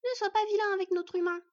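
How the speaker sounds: put-on high, loud child's telling-off; bossy small child protecting a friend; little girl scolding. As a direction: scold in a little fox girl's voice. Fox girl scolds the elf.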